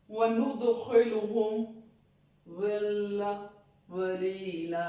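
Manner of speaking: slow chanted tajweed recitation; measured Quran chant; Quranic recitation. Shown as a demonstration. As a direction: recite the verse slowly, melodically.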